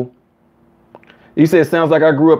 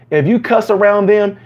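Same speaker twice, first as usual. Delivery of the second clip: ordinary talk, nothing marked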